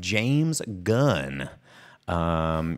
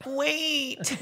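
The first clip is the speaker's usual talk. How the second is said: high mock plea; 3 dB quieter than usual. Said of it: "the Megan skit from Key and Peele".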